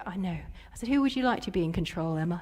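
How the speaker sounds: put-on soft, low, gentle voice to a child; calm her down very quietly; soothing mother. Mother asking daughter who should be in control, soothing.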